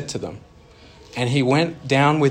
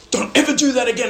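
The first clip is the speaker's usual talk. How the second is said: much higher, louder parental scold; 10 semitones higher, 5 dB louder, faster than usual. Retold or imitated. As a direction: scold a kid who got lost. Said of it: "then they're like".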